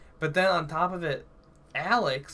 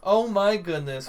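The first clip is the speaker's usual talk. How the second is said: excited greeting; 6 semitones higher, 7 dB louder than usual. Real, not acted. Dog interrupts, greeting.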